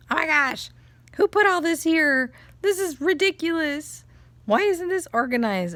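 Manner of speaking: loud, high-pitched exasperated rant; angry son. Retold or imitated. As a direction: imitate a kid fuming in outrage. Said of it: "he started getting so angry".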